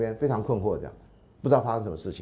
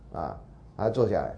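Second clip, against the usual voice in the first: ordinary talk, nothing marked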